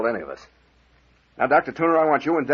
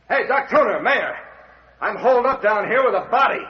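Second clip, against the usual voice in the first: calling up; 9 semitones higher, 4 dB louder than usual. Acted. Captain calls up from cellar.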